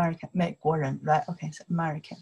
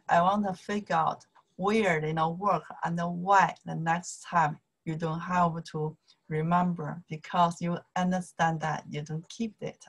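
usual speaking voice

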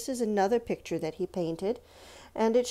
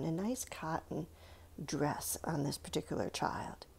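whisper; 5 semitones lower, 10 dB quieter than usual. Real, not acted. Teacher whispers while describing.

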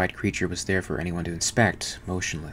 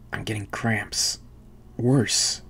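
in pain; 3 semitones higher, much slower than usual. A narrator's reading. Kane tells the worried crew his cramps are worsening, strained. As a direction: push the words through cramps.

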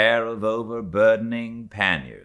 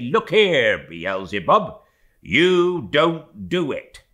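higher and louder, slowing on the last words; mock threat to the devil; 6 semitones higher, 4 dB louder, slower than usual. Stubb acting out grabbing the devil, mock menacing warning. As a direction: square up to Beelzebub and warn him off. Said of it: "take him by the nape of the neck and say".

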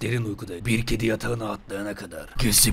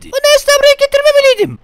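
piercing falsetto, very high, loud and fast; shouting cat; 12 dB louder, much faster than usual. Pretend. Babysitter voicing the regretful cat, hammed-up shrieking. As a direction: squeal out the cat's regret in a rush. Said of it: "diye bağırdı kedi".

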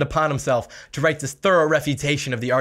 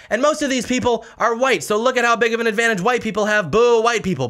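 mock outrage, higher and louder; mocking imitation; 7 semitones higher, 4 dB louder than usual. Mocking the author to viewers, higher and louder in mock outrage. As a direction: sneer his point back at him, hammed up. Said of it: "he jumps and says".

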